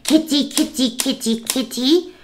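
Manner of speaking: high, slow sing-song kitty call; lady cooing to stray cats through the window; high sweet cat call. Retold or imitated. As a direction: coo at the cats like a little girl. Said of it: "That's how she was".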